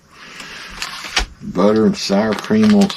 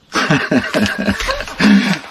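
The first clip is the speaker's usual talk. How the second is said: high burst of laughter; laughing at himself; 9 semitones higher than usual. Real, not acted. Laughs at own ad read.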